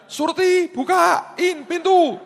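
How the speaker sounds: high, slow screechy parrot call; a parrot imitating its owner calling the maid, very high and drawn out; parrot screeching for maid. Parrot mimicking the owner calling the maid, high shrill screech. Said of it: "dia teriak panggil pembanti".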